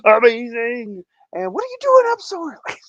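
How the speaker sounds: high, loud, teasing squeal; playful gush. Real, not acted. Teasing Debbie for being up early, a high playful squeal. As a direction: tease her fondly for being up so early.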